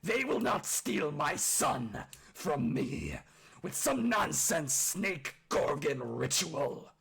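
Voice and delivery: slow, loud gruff orc roar; furious shout. A narrator's reading. Orc father hurls the shield, furious shout. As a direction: shout it out, hard on every word. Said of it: "and then shouts".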